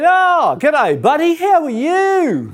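loud, very high, hearty Aussie greeting; hammed-up Aussie accent, drawn out at the end. Aussie driver greeting a lost stranger, excited Aussie accent. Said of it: "it's an Aussie accent".

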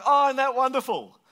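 high, loud gush of approval; gushing delight. Acted. Reacting to someone's news, hoping it isn't bad, gushing. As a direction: gush as if it's marvellous news.

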